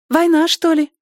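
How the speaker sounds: put-on very high, childlike question; naive. A narrator's reading. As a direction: ask innocently, like a little girl. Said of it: "наивно спросила Поля".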